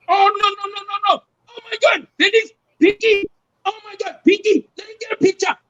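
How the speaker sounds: put-on very high, thrilled Indian accent; imitated Indian accent. Friend wants a picture, high imitated Indian accent. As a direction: squeal like a starstruck fan, Indian accent. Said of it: "then Punjab come over".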